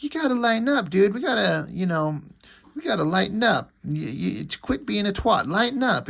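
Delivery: high-pitched whiny little character voice; Troll. Acted. Troll telling Shaft to lighten up, high pestering voice. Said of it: "And then Troll is like".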